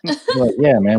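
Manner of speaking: ordinary delivery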